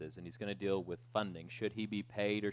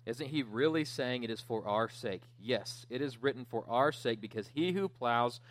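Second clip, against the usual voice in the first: raised, emphatic reading from the pulpit; scripture reading; 4 semitones higher, 4 dB louder than usual. Acted. Preacher reads Paul's defense to the church, emphatic scripture reading. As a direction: read it out forcefully, driving each rhetorical question.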